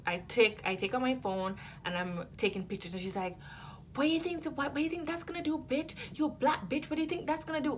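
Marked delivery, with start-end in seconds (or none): 3.9-7.7 high, fast, furious retort; shrill angry customer. Retold or imitated. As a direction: sneer it at her, pitched way up. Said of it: "I lost it".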